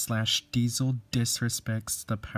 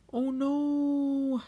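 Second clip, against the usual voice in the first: very high alarmed scream; a piercing cry of alarm; 3 dB louder than usual. Acted. James crashing, very high scream of dismay. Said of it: "screamed James".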